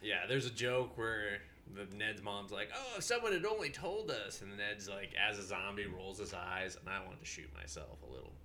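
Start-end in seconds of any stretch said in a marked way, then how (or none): 2.8-4.4 high-pitched mock lament; imitated movie mom. Ned's mom wishing someone had told them, mock lament. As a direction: lift it high like a regretful mother.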